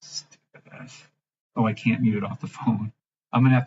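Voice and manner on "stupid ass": whispered under breath, slow; muttered insult. Real, not acted. Muting TikTok, a slow whispered insult. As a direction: hiss it off mic. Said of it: "I gotta mute TikTok".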